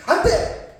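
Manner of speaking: high sudden yell of no; sharp cry. Shown as a demonstration. Stopping an elder from stepping off a cliff, high sudden yell. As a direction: yell to stop an old man at the cliff edge. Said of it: "그래야 돼요?".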